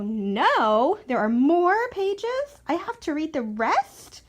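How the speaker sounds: hammed-up horror that the book goes on; mock dismay. Reader feigning dismay at more pages.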